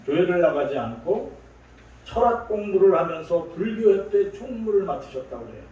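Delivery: ordinary talk, nothing marked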